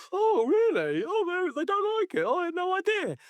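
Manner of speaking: mocking Barmby feigning surprise at the fans' anger, high and whiny; mock innocent. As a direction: play dumb in a squeaky put-on voice. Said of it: "Not like".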